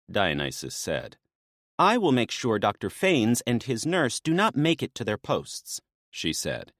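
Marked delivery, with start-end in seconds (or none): 1.8-5.8 lightly voiced woman, brisk and businesslike; a hint of a maenad laying out the plan; briefing maenad. Naya briefing Dionysus on the rescue plan, lightly voiced.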